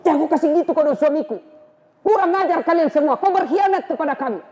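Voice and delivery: high, fast and sharp; furious sister. Acted. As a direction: defend her husband fiercely. Fatimah confronts Umar angrily.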